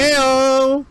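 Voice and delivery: high, loud whoop; excited cheer. Real, not acted. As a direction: celebrate the good roll. Players cheer the roll.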